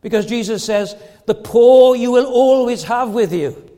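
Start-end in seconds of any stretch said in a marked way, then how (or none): 1.2-3.7 loud, raised, deliberate pulpit delivery; forceful preaching. Real, not acted. Preacher quotes Jesus on the poor, forceful preaching. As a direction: press every phrase on the congregation, loudly.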